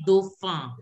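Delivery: dofa with no N, ending nasal; silent N. Shown as a demonstration. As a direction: say dofa with the final N dropped. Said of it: "is not to be pronounced".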